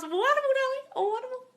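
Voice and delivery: high falsetto sing-song praise; mock delight. Coach coos praise at a viewer's money news in falsetto. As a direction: coo it way up high, playfully.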